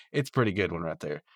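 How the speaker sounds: usual speaking voice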